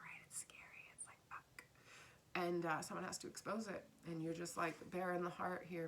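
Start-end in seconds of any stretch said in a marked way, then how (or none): 0.0-1.6 hushed, breathless whisper; hushed awe at a frightening truth; awed whisper. Real, not acted. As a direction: whisper it like a scary secret.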